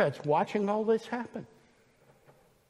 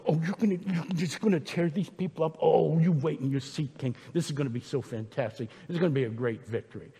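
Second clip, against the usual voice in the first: put-on quick, eager, childish flattery; a lightly voiced eager boy flattering the king; little boy voice; 4 semitones lower, 5 dB quieter, faster than usual. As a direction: gush like an excited little boy. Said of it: "He's like a little boy".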